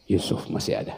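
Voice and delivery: breathy whisper of Yusuf masih ada. Acted.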